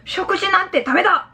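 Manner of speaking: firm, rigid acted objection; stiff fatherly firmness. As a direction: say it stiffly, like a dad laying down the law. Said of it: "이렇게 좀 딱딱하게".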